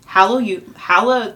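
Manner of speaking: tipsy, tripping over "Hallelu-"; slurred, failing to say "halla-". Real, not acted. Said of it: "I can't say that word".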